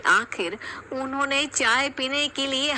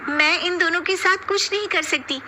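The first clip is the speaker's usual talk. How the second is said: high-pitched whiny daughter-in-law; complaining elder bahu; 8 semitones higher than usual. Acted. Eldest daughter-in-law complaining to mother-in-law, high and whiny. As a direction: protest to mother-in-law, aggrieved.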